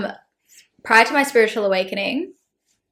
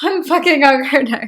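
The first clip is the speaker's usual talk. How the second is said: laughing; 5 semitones higher, 4 dB louder than usual. Real, not acted. Laughing admission.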